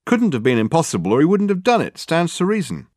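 high-pitched secretary voice, dismissive; high, no-nonsense retort; matter-of-fact secretary. Acted. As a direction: state the obvious, pitched well up.

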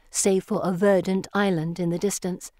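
ordinary delivery, nothing marked

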